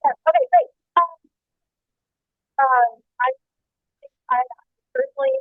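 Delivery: cutting in and out over a bad line; phone call from a moving car, breaking up; corrupted glitchy audio. Real, not acted. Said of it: "You're cutting a little bit in and out".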